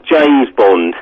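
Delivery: usual speaking voice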